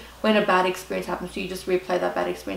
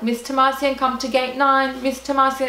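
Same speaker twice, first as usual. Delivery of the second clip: airport announcer; 6 semitones higher, 3 dB louder than usual. Retold or imitated. Airline calling a late passenger to the gate, imitated announcement. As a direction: call out a passenger like a tannoy announcer.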